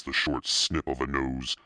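ordinary delivery

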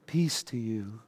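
very soft, low-key movie Jesus; slow serene. Retold or imitated. Movie Jesus appearing to disciples out of fog, slow and serene. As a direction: parody a serene, hushed film Jesus, drawn out. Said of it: "he says very slowly".

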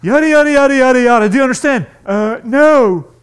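loud, high, rushing yada yada; hammed-up overeager advice-giver; frantic mock helper piling on tips; flustered helper. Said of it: "We're like".

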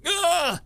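high hammed-up yelp; mock pain cry. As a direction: fake being hit, overdone. Dramatic fake injury.

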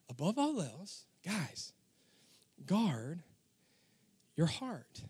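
soft, slow, tender plea; nice way. Shown as a demonstration. Preacher tenderly urging the congregation, soft and slow. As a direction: hush it down like a loving father. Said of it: "He says it in a nice way, not a mean way".

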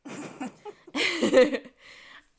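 big loud laugh; loud amused laugh; loud burst of laughter. Real, not acted. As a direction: laugh out hard. Laughing at villain line.

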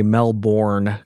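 "Melbourne" said as mel-BORN, as it looks; Californian pronunciation. Shown as a demonstration. Host shows his California way of saying it, mel-BORN. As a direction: sound out "Melbourne" with a full BORN. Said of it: "with my California accent here".

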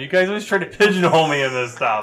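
indignant. Acted. Character protests the accusation.

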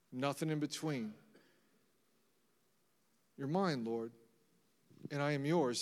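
soft, hushed declaration to God; intimate prayer. Shown as a demonstration.

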